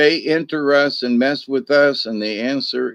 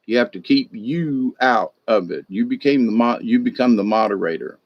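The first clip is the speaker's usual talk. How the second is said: ordinary talk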